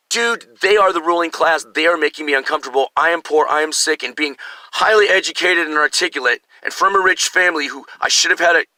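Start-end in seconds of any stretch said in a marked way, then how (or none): none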